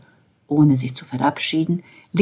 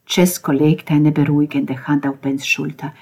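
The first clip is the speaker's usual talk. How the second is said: plain reading voice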